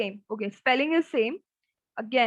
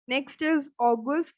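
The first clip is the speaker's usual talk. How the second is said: usual speaking voice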